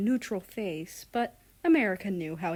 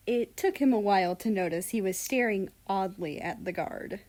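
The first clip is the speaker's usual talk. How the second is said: plain reading voice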